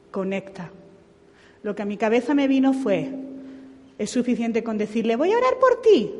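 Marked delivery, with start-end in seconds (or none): 5.2-6.1 mucho más aguda y un poco más fuerte, algo burlona; frase hecha ligera. Retold or imitated. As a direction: remeda la frase fácil de quien sólo promete orar. Quoting his own offer to pray. ("Voy a orar por ti" dicho agudo y de pasada)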